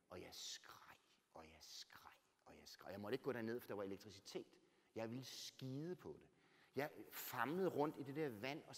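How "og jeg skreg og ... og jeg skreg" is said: breathless whisper, almost no voice; a scream told as a soundless whisper; hushed whisper. Acted. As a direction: whisper the scream as if choked by grief. Telling of screaming in flooded cellar.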